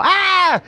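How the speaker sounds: short high mock shriek; imitated scream. Friend screaming in panic, short high mock shriek. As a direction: yelp it like the scared friend.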